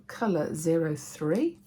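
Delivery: normal talking voice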